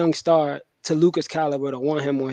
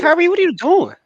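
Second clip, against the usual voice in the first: much higher, put-on voice of a baffled Luka; bewildered Luka; 12 semitones higher, 3 dB louder than usual. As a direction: ask him what on earth he's doing. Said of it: "Luka probably already like".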